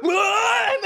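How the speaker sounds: high, loud blown-mind shout; hammed-up shock through laughter; mock stunned cry. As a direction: react as if your head is exploding, laughing. Acting out heads exploding.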